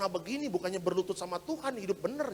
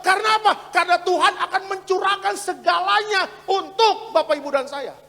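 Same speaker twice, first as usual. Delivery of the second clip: loud, high, fervent preacher; preaching at full force; 11 semitones higher, 14 dB louder than usual. Real, not acted. Pastor urging gratitude at church, preached at full force. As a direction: build to a ringing promise.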